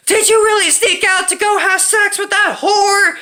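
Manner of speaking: high, drawn-out mock wail; freaking-out mom. Mom confronting son about sneaking out, mock hysterics. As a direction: imitate a mother losing it, tearfully. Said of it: "my mom decides to freak out".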